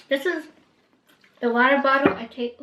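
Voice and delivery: talking with mouth full; words around a mouthful of food. Real, not acted. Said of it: "I don't wanna talk with my mouth full".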